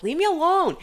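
put-on high, pleading little kid voice; high whiny plea. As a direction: whine it like a bullied kid. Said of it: "And I was like".

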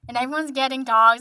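normal talking voice

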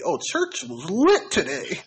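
put-on high, slow old lady voice; old church lady. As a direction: play a churchy old lady using slang. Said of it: "How do you look at some old lady?".

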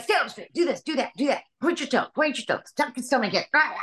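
high mock drill-sergeant teacher; beastly dance teacher. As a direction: rattle off orders like a tyrannical dance instructor. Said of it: "she was a beast".

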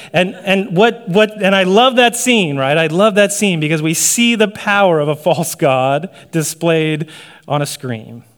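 ordinary talk

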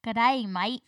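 broad Aussie "G'day, mate"; Australian accent. Shown as a demonstration. Guest showing off accent learned from Bluey, Australian accent. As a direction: say it like a cheerful Australian. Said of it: "so good at this accent".

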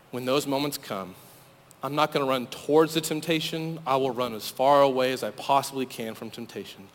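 preacher quietly urging students to return to God; hushed appeal. Acted. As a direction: draw listeners in gently, almost confiding. Hushed altar-call appeal.

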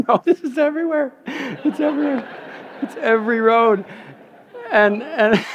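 high, laughing disbelief. Real, not acted. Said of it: "I shouldn't be laughing".

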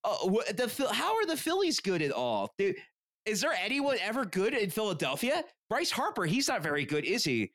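put-on high, whiny grating American accent put-down; mocking the Idaho dad's Phillies jab in a grating American accent. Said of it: "I can't even do his voice".

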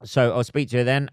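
usual speaking voice

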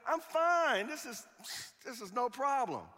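high, loud carefree brush-off; breezy I'm fine. Retold or imitated. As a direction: shrug it off in mock cheer. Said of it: "He says".

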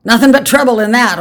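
put-on loud, high, stubborn old man; stout. A narrator's reading. Old man rejects quitting lotteries, stout and loud. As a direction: declare it stoutly, brooking no argument. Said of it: "Old Man Warner said stoutly".

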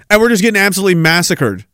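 ordinary talk, nothing marked

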